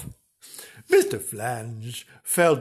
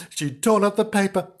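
ordinary delivery

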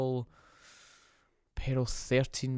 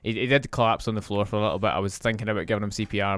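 ordinary talk, nothing marked

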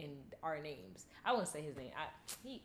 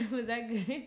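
slow, laughing question; 3 semitones higher, 3 dB louder, slower than usual. Real, not acted. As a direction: ask it still laughing. Laughing after her roar.

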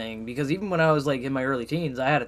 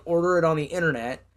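ordinary talk

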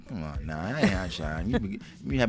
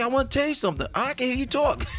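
mock wife voice; 10 semitones higher, faster than usual.

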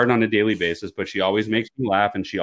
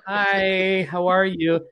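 mimicking sing-song; 11 semitones higher, much slower than usual. [name] mimics back in kind.

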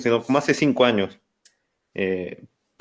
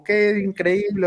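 much higher, drawn-out broadcaster cry; mock TV commentator; 11 semitones higher, slower than usual. Imitating the TV commentator after the tying shot, hammed-up. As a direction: ham up a stunned sports announcer.

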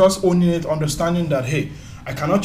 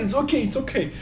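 polite brush-off; 8 semitones higher than usual. Retold or imitated. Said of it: "So they'll tell you".

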